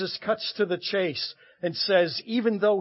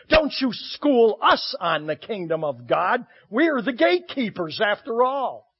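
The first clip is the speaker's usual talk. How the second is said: loud, indignant protest from the gatekeepers; louder, higher mock outrage; protesting peers; 7 semitones higher, 8 dB louder than usual. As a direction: scold him as offended religious elites. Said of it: "The peers would have been protesting".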